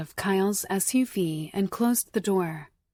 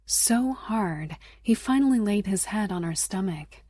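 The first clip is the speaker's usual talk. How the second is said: ordinary delivery, nothing marked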